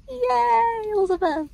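high, soft mock cheering; playful self-cheer. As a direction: cheer yourself on in a tiny, high voice. Cheers herself for finishing challenge.

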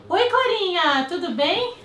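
talking to the dog. Acted. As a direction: coo at the dog. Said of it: "Quer ver?".

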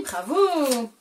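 ordinary talk, nothing marked

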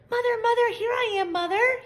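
high, loud eager calls; calling out. A narrator's reading. Baby bird runs to the big thing calling, high and loud. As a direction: run up calling for mommy.

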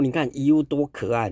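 usual speaking voice

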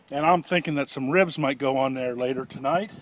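normal talking voice

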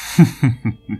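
scornful snort of a laugh; mock him with a nasal snicker; sneering laugh through nose. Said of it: "zaśmiał się szyderczo przez nos". (szyderczy śmiech przez nos)

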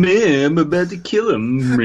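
lightly playing a creepy Poe; mock Poe voice. Friend mocks the reader as Poe, mock-spooky. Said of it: "Is that Edgar Allan Poe?".

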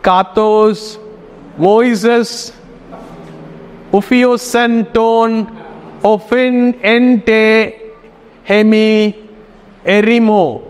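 word-by-word reading, gaps between words; sounding out Greek. Shown as a demonstration. Teacher showing beginners how to read the alphabet, sounding out. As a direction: read haltingly, each word held on a level tone. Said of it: "try reading".

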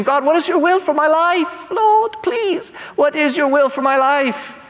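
high-pitched mock plea to God; pleading prayer. Believers asking God about their lives, pleading. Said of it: "we ask the question".

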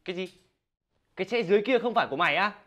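ordinary talk, nothing marked

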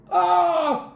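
high, loud "aah" scream; cry of pain. A narrator's reading. Miss Swine at the blazing heat, high scream. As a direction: cry out as if scorched. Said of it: "cried Miss Swine".

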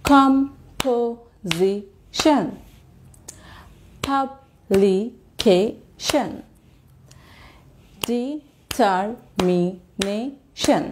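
"composition", "publication", "determination" broken into evenly spaced syllables; very slow drill, a gap after each syllable of "composition", "publication", "determination". Shown as a demonstration. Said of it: "প্রতিটা শব্দের প্রতিটা অংশ বলবার সময়".

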